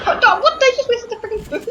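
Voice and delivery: shocked laughing shout. Real, not acted. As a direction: yell in shock, half laughing. Teammates waste the food.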